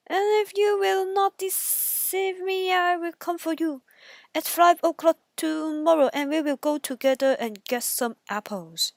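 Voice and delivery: pushy wolf making plans; wolf pressing the pig; eager wolf. Acted. Wolf sets a time.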